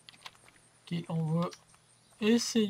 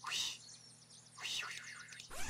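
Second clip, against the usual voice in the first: whispered yes yes yes; relieved whisper; 19 dB quieter than usual. Real, not acted. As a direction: breathe it out in relief. Bleeding stopped, relief.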